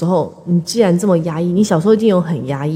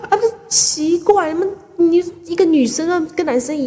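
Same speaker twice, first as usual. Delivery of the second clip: high, mock-scolding teacher voice; a lightly hammed-up stern teacher; imitating the teacher; 11 semitones higher than usual. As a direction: play the nagging teacher, pitched up.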